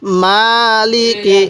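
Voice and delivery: মালিকি read very slowly, with মা held long and লি and কি kept short; slow drawn-out recitation. Shown as a demonstration. Teacher reciting মালিকি for students, slowly drawn out. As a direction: stretch only the মা in মালিকি. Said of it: "কীভাবে পড়ব".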